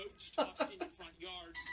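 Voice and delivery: loud, slowed shout over the storm; shouting over wind. Real, not acted. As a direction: belt each word over the wind. Reporting from storm zone.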